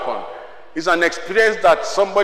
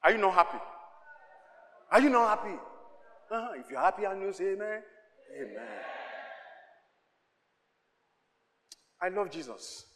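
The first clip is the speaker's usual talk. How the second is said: much softer, murmured asides; 14 dB quieter, faster than usual. Real, not acted. Preacher quietly asking the congregation if they are happy. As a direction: drop almost to a murmur.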